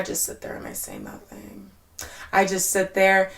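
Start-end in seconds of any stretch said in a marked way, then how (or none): 0.0-1.7 whispered confession. Retold or imitated. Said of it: "And this is my fucking problem".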